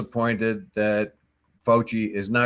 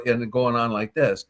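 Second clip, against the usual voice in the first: ordinary talk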